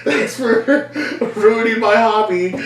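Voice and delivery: high laughing mock thanks; sarcastic farewell through laughter; sarcastic kid. Boy sarcastically thanking aunt at Thanksgiving, high and laughing.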